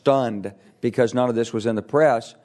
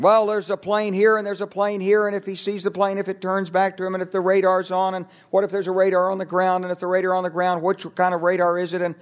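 higher-pitched, run-on mock debate; imitated bickering in a raised, steady pitch; mock arguing; 5 semitones higher than usual. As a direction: pile clause on clause like a muddled argument. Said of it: "And they're arguing".

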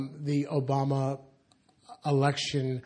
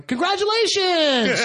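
much higher, louder, a drawn-out mock cheer with a laugh; gushing congratulations; 6 dB louder, slower than usual. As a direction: ham up the friends' gushing cheer.

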